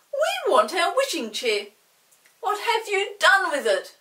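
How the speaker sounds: loud, high demanding pixie; angry demand. A narrator's reading. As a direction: press him hard. Chinky demands chair.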